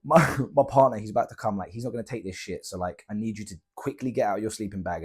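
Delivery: ordinary talk, nothing marked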